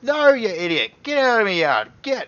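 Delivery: high, loud furious shout; angry dwarf. Acted. Old dwarf yelling the guards out of his yard, high and loud. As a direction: bellow like a cranky old dwarf. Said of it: "The fella goes".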